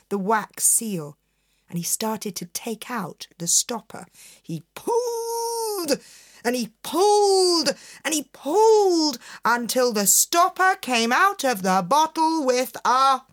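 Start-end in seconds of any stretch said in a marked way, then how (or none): 4.4-13.2 straining build. A narrator's reading.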